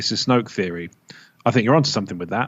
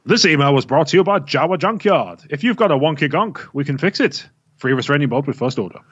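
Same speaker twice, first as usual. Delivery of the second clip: announcer voice; 4 semitones higher than usual. Acted. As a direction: sell it like a radio ad. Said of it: "Announcer voice".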